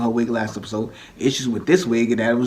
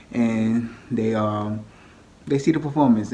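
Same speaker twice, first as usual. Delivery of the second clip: usual speaking voice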